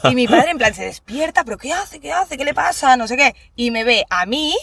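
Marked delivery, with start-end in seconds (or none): 1.4-3.0 alarmed father. Retold or imitated. Father's startled questions retold.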